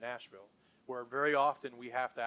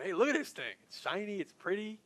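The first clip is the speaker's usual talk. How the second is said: a bit higher, bright and eager; excited; 5 semitones higher than usual. Retold or imitated. Sales team showing off the car, mildly excited. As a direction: gush over the shiny product. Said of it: "we could get excited about that".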